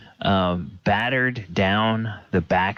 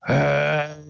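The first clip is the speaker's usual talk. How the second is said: high strained grunt; a cry before charging; cultist grunt; 7 semitones higher than usual. Acted. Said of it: "he's like".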